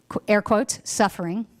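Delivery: ordinary delivery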